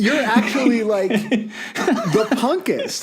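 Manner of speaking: laughing while drawing out punkest. Real, not acted. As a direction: giggle through the words, stretching punkest. Laughing at friend's punk cred.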